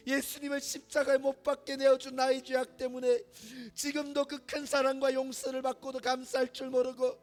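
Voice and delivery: pleading prayer, rising to full force; fervent prayer. Acted. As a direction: cry out to God in full-voiced repentance. Fervent group prayer builds.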